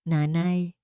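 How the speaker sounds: daughter-in-law faking sadness to her mother-in-law; fake grief. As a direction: sound heartbroken but it's an act. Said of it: "mahinang bulong na puno ng pekeng dalamhati".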